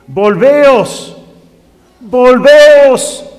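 loud, drawn-out appeal; ringing cry from the pulpit; urgent preaching call. Acted. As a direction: call the people back, loud and slow. Preacher cries out God's call.

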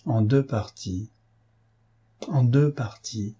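slow, musing repetition; memory awakening. A narrator's reading. Old witness recalling the cigarette case, slowly musing. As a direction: draw the words out as memory stirs. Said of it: "dont les souvenirs semblaient réveillés par ce détail".

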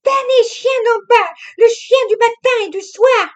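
put-on high, loud, hurried young woman insisting; the wife covering her lie with chatter; young wife, insistent. A narrator's reading. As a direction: press him to listen, too eager. Narrator voices Camille.

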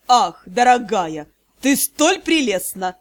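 медленно, ниже, воркующий граф; ворковать над дамой; воркуя. Acted. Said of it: "начал ворковать он". (воркующий голос)

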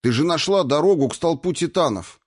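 high, agitated objection; incredulous protest. Acted. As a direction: protest in disbelief. Аратарн спорит с её отчаянием. (возмущённо, не веря)

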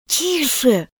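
high, hushed, alarmed warning; frightened. A narrator's reading. Scared rabbit silencing the girl, frightened hush. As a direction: shush her in a frightened high voice. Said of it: "испугался кролик".

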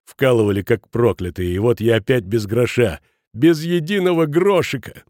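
aggrieved, trembling complaint. A narrator's reading. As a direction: complain bitterly of being left penniless. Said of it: "дрожащим голосом продолжал Шмидт". (дрожащим от обиды голосом)

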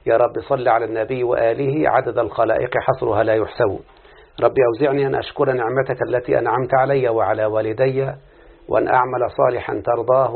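low, steady devotional recitation; opening prayer. Acted. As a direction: recite the supplication calmly before class. Opening prayer before the lesson. (دعاء بصوت خاشع هادئ)

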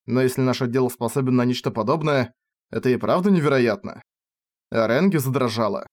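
ordinary delivery, nothing marked

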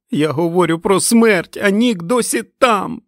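high, loud, desperate yell; screaming. A narrator's reading. Fran warns Stu that Nick is inside, screaming. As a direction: scream to make him understand. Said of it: "закричала вона до нього".

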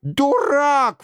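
high, outraged royal exclamation; crying out. A narrator's reading. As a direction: burst out as an insulted king. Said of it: "вскричал Генрих".

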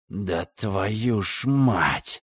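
slow, hissed curse under breath; whisper yelling. A narrator's reading. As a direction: hiss the curse through teeth. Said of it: "прошипел комиссар".

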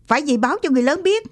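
high, very fast exclamation; mild acted yell, pitched high; urgent yell. A narrator's reading. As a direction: raise the alarm in a rush. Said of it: "Thằng Tí hét lên".

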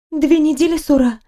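a cheerful nurse informing her patient; chirpy nurse. Acted.

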